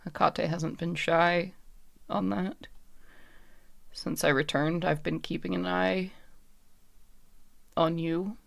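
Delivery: soft, halting confession with long pauses; lightly voiced spirit picking her words with care; guarded puppet woman, hesitant. Puppet woman explains why she was brought back, soft and halting.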